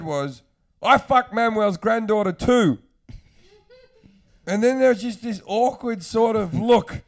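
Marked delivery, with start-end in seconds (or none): 0.8-2.8 blurted confession. Retold or imitated. Starstruck [name] blurts his confession to [name], loud.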